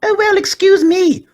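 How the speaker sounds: very high, loud, slow offended huff; high pug voice, huffy. A narrator's reading. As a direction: sniff it out, deeply insulted. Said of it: "said Porky in a huff".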